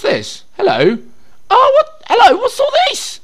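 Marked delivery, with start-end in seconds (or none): 1.5-3.2 very high, loud falsetto amazement; squealed surprise. Real, not acted. Player finding a new menu, squealing in surprise. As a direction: squeal it at a sudden find. Delighted discovery in game menu.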